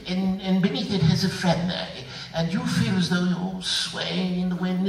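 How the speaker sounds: lightly voiced director spinning a tree metaphor; mock arty director. Imitating a director giving flowery notes. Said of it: "If a director says".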